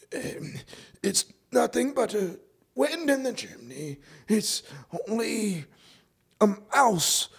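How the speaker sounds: put-on a frightened old man insisting it's nothing; old man reassuring himself. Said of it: "He had been saying to himself".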